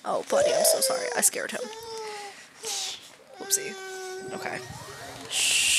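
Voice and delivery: high, tender consoling voice; soothing the son. Real, not acted. Comforting crying son.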